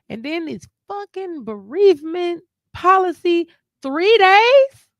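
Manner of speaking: venting outrage at the short leave; outraged disbelief. Real, not acted. Complaining to a friend about bereavement leave, outraged.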